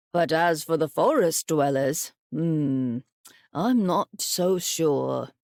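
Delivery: a grandfather pondering calmly; old grandfather. Acted. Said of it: "said her grandfather".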